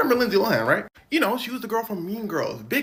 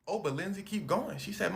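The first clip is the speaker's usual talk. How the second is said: ordinary delivery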